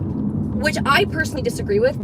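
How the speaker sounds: normal talking voice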